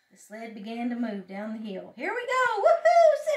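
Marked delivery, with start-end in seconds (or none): 2.0-3.3 high, loud cheer rising to a whoop; thrilled whoop. A narrator's reading. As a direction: whoop as the sled takes off. Frog starts the sled ride.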